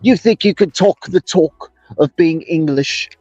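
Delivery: mock English bloke voice greeting a British viewer; English accent mode. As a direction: put on a cheeky English accent, laying it on thick. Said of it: "I went right into English accent mode for him".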